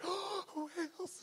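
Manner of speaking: high, breathless gasp of delight; mock awestruck child, squeaked after a gasp; excited little girl. Little girl hearing about whales in class, thrilled and high-pitched. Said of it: "And she's so excited".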